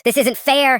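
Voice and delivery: high wailing protest; tearful outburst. A narrator's reading. Sister wailing at grandpa through tears. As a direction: cry it out like a spoiled daughter. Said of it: "Victoria burst into tears".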